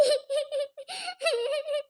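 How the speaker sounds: a soft sob between sentences. Acted. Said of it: "ಅಳ್ಬೇಡ". (ಮೆಲ್ಲಗಿನ ಬಿಕ್ಕಳಿಕೆ)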